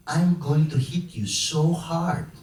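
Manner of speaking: usual speaking voice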